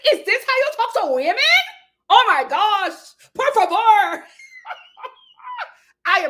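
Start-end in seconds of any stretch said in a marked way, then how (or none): 0.1-4.3 high, loud theatrical outrage; hammed-up impression of an affronted woman; mock Alexia. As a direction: play it scandalized, voice pitched way up. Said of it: "I'm working on my Alexia".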